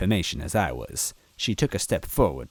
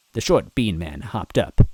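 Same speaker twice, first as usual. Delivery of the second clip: ordinary delivery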